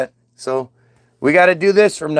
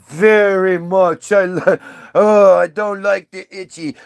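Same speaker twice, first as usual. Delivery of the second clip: dim Eve; 3 dB louder, slower than usual. Retold or imitated. Eve voiced again.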